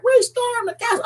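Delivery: high-pitched mock battle boast; mock triumphant. Host imitating the castle stormers, mock triumphant.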